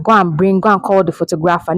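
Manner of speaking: quick mock example, "photographer" as foto-GRAF-a; hurried everyday misuse of "photographer", stress off the second syllable; wrong pronunciation. Shown as a demonstration. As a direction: rattle it off, saying "photographer" like photo-grapher.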